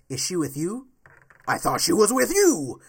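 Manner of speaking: lightly voiced Batman, loud at the end; quoting Batman. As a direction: throw out the movie line as Batman. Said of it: "Batman says".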